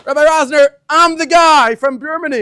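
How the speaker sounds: eager greeting. Retold or imitated. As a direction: hail the rabbi across the shul. Guest greeting rabbi at shul.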